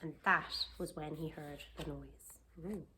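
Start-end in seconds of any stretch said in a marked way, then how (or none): none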